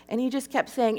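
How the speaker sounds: ordinary talk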